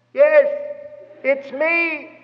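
high, calling voice; yelled reply. Acted. Jesus answering Peter across the waves, calling out. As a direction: call back over the storm in acted shout. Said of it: "yelled back".

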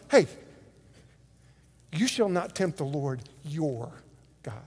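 low, soft, firm warning; confrontational Jesus. Acted. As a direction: square up and warn someone off quietly. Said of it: "I see it much more dramatic".

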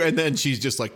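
ordinary delivery

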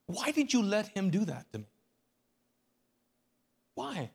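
high, loud pleading cry at God; anguished demand. Retold or imitated. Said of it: "you're like".

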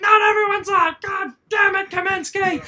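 high, loud imitated outburst; shouted scolding, starting loudest; boss yelling. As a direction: scream at the driver like an irate boss. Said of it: "I got yelled at".

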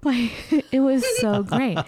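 laughing through the words. Real, not acted. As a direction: giggle while saying it. Laughing after the shared scream.

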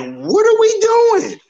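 very high-pitched, drawn-out incredulous question; exasperated disbelief. Real, not acted. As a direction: let the voice leap up in exasperation. Exasperated rant about the culture.

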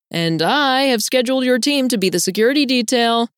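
high, lilting sing-song; sing-song cajoling. A narrator's reading. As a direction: dangle a treat as if to a child. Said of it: "cajoles me with a sing-song tone".

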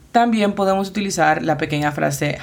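ordinary delivery

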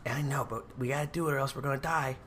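urgent hushed whisper. Acted. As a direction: whisper it under your breath. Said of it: "We can probably stop whispering too".

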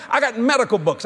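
ordinary talk

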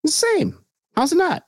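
high-pitched sarcastic protest; sarcastic mock outrage. Sarcastic agreement about Greene.

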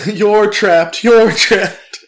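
high loud yelling; shouting. Retold or imitated. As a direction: shout the warning urgently. Voicing friends in movie.

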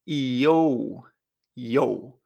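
"Yo" as a low, drawn-out i-o-u; o in the middle. Shown as a demonstration. Teacher repeats the sound with an O between, correct pronunciation. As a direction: stretch "yo" from i through o to u. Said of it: "como si hubiera una O en medio".

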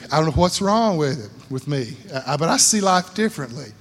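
ordinary talk

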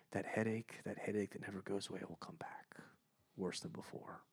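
dread-filled whisper. Real, not acted. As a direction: whisper it like a curse.

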